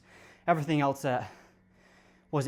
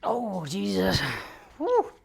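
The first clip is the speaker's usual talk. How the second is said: strained yelp, pitch shooting up; lifting weights; 6 semitones higher, much slower than usual. Real, not acted. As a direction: gasp it out after a brutal rep. Straining through a tough set.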